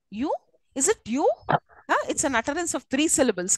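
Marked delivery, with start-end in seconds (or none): 0.1-1.3 "you" rising like a query. Shown as a demonstration. Teacher reading the example sentence, querying rise on "you". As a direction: end "is it you" on a lifted "you". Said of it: "it's like a query for me".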